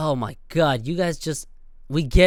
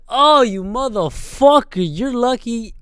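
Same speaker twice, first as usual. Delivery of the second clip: slow, higher and louder furious snarl; furious driver; 7 semitones higher, 6 dB louder, slower than usual. Retold or imitated. Driver cursing the biker who kicked his door, slow furious snarl. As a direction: drag out the curse like you want to fight. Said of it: "I'm like".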